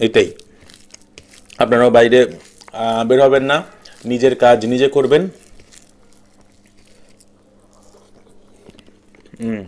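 speaking with food in mouth; eating between words; talking while chewing. Real, not acted. Seen 0: Eats rice by hand between phrases; mouth seems partly full while speaking.

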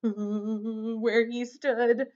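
drawn-out quavering shiver into the words; trembling. A narrator's reading. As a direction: act out a shivering little goat. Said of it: "Little Billy Goat Gruff trembled".

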